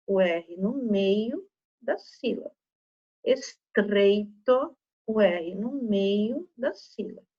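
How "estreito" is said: "Estreito" very slow, the R pushed; forced R. Shown as a demonstration. Teacher helping pupils notice the sound, forced R in "Estreito". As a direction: stretch "Estreito", leaning on its tr. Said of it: "dando essa forçadinha no R".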